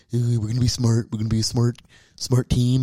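mild mock of a timid, dull coach at the podium; a meek nerd reciting safe talking points; dweeby coach impression. Mocking the new Eagles coach at his press conference, timid and dull. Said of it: "he's like".

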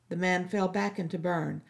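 plain reading voice

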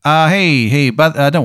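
high, hesitant lawyer; opposing lawyer voice. Acted. Opposing lawyer backing off from the lawsuit, hesitant. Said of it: "It's from the opposing lawyer".

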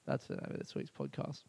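very low, very soft; hushed aside. Real, not acted. Host confirming the podcast's name, hushed aside. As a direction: drop right down to a murmur. Aside after drawn-out title.

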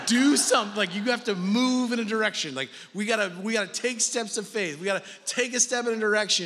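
loud, high preaching push; forceful urging. Real, not acted. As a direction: drive it home to the congregation. Preacher urges action.

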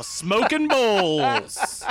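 loud, drawn-out title shout; mock show announcer. Co-host jokingly renames the podcast, loud drawn-out announcer call. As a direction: stretch the title out like a hype announcer.

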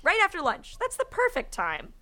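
high-pitched mock delight; eager agreement. A narrator's reading. Someone in the crowd approving the time, eager high voice. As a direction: chime in high, as if thrilled by the plan.

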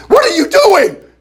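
a stranger caught off guard, demanding; stunned and indignant; startled demand. Retold or imitated. Stranger startled by man grabbing cart.

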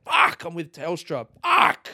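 rising into a high loud shouted curse; frustrated swearing. Acted. Telstra customer curses his bad phone with a loud high yell. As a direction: snap it out in disgust, then howl.

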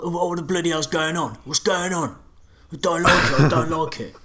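high, loud, panicky babbling; wide-eyed panic. Acted. New recruit sucked into violence, voice rising in panic. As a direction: play a newcomer losing his nerve. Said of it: "kind of wide-eyed stare, like".

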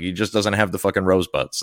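ordinary talk, nothing marked

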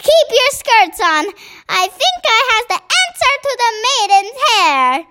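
high-pitched, eager giant; excited cry. A narrator's reading. BFG tells the officers he has the answer, high and excited. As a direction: burst in high with an idea. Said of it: "cried the B F G".

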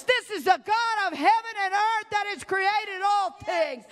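fervent revival preaching, crying out; preaching at full force. Acted. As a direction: build the congregation to a shout. Builds to climactic preaching.

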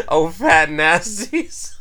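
cracking up mid-word; giggling through the punchline; laughing. Real, not acted.

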